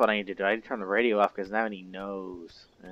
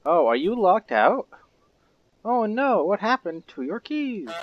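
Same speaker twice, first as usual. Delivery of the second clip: high, loud mock sympathy; 10 semitones higher, 9 dB louder than usual. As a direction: fake pity, high and loud. Mocking locked-out gardener.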